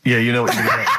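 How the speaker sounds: usual speaking voice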